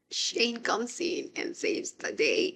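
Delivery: usual speaking voice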